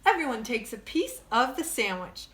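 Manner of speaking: plain reading voice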